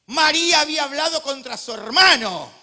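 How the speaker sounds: loud, high, fiery sermon delivery; booming pulpit voice; full-force preaching. Acted. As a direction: preach it out to the whole hall.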